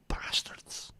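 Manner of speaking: a whispered, breathy "Bastards"; whispered curse. Real, not acted. Cursing the emails in a whisper. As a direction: hiss it under your breath.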